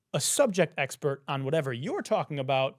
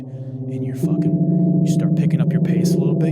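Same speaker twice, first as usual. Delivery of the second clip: much louder, rushing as the chase starts; frantic loud telling; 11 dB louder than usual. Acted. Describing running from a T-Rex to viewers, loud and frantic. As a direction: yell it like you're running for your life.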